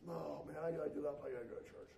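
reluctant sigh. Retold or imitated. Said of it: "I've done this before too".

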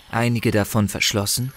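usual speaking voice

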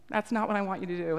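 usual speaking voice

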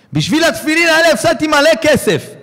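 high, loud wail of loss; loud, high lament over the lost money; anguished cry to God. Retold or imitated. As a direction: cry it up to heaven in despair. Man cries out to God.

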